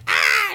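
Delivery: short high-pitched scream; mock scream. Girl pulled away in the rain, mock scream. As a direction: cry out as if being dragged.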